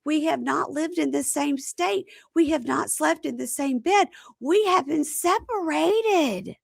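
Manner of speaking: high, loud, drawn-out protest; mock protesting woman. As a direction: stretch the words in exaggerated high-pitched indignation. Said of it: "And she goes".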